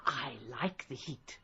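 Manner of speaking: sinister whisper; menacing whisper. Acted. Poisoner taunting her drowsy victim.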